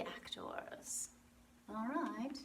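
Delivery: ordinary talk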